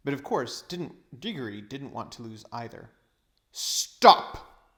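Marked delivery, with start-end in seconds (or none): 3.5-4.8 loud, commanding shout; witch's cry. A narrator's reading. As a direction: bark the order at them. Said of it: "cried the witch".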